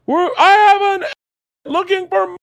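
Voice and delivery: high, stretched-out shout over a crowd; top-of-lungs scream. Retold or imitated. Man shouting through the parade for a lost kid's dad, screaming. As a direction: call out at full stretch, very high. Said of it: "screaming at the top of my lungs".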